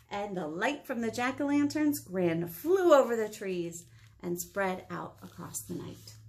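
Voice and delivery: plain reading voice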